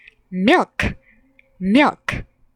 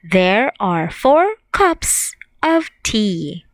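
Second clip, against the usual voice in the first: slow model sentence; slower than usual. Shown as a demonstration. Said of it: "We can say".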